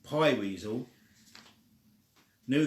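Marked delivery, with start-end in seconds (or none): none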